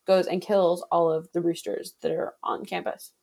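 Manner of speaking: ordinary delivery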